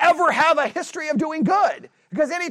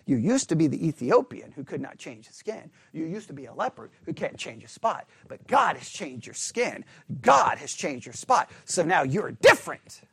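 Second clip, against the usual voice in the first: imitating a preacher; 9 semitones lower, 5 dB quieter than usual. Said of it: "sermon that goes something like this".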